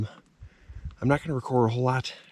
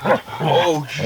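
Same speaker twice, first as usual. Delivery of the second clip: usual speaking voice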